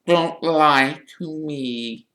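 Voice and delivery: slow, high, tearful wail; gasping tearful Myrtle. A narrator's reading. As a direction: protest through tears, drawing words out. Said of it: "Myrtle gasped, tears now flooding".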